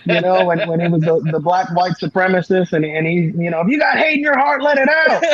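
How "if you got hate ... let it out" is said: quoting the sketch in a much higher voice; Clayton Bigsby impression. Quoting the Chappelle sketch to a friend, high-pitched mock preacher voice. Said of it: "Clayton Bigsby sketch".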